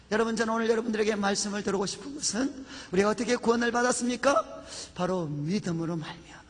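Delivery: usual speaking voice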